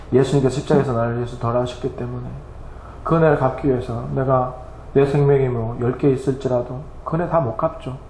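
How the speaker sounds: ordinary talk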